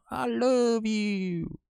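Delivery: very high, drawn-out mock swoon; mock lovey-dovey. Viewer mocking soap-opera storylines, high gooey voice. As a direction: stretch it out in a silly high voice.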